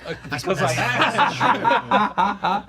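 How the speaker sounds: bursting, slow and loud with a laugh; can barely hold in the spoilers; quivering with excitement. Real, not acted. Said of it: "I'm quivering".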